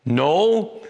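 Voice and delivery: ordinary talk, nothing marked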